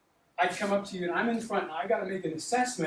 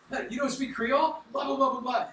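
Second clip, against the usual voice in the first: mock taunting; 7 semitones higher, 4 dB louder than usual. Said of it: "they love busting my chops".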